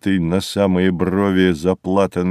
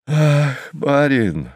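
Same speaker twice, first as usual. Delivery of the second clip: drawn-out sorrowful sigh, raised pitch; heavy sigh; 5 semitones higher, much slower than usual. A narrator's reading. Bereaved cabman sighing to his passenger, sorrowfully. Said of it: "тяжело вздохнул бедняк".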